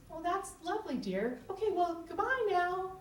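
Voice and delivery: mother's strange high voice, ending the call fast. Retold or imitated. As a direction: mimic a strangely high, stiff goodbye. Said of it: "in a really strange voice".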